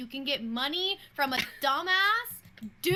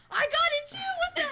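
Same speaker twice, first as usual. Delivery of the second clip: high, outraged women; high indignant quote; faster than usual. Retold or imitated. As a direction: squeal it in disbelief. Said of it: "everyone's like".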